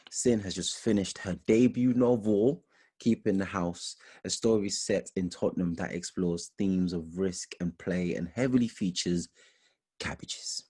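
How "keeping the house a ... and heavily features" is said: ordinary delivery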